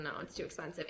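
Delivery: low, soft flat no, too expensive; dismissive skeptic. Retold or imitated. Friends refusing Bitcoin at twenty thousand, low and dismissive. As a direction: brush it off, a bit muttered. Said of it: "Like forty thousand".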